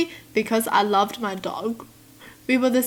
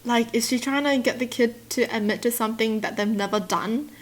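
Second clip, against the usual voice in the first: ordinary delivery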